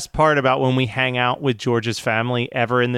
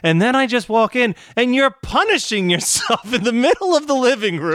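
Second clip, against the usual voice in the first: imitating mom; 9 semitones higher than usual. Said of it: "she's just like".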